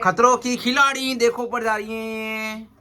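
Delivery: high, slow, drawn-out proclamation; hyped announcement. Real, not acted. Man announces her climb as a daredevil feat, hyped drawl. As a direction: stretch it out like a stunt-show announcer.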